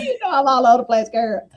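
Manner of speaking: fast and giggly admission; laughing self-deprecation. Real, not acted. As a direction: laugh at yourself as you say it. Laughing at own scattered talk.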